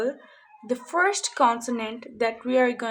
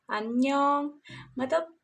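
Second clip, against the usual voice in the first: normal talking voice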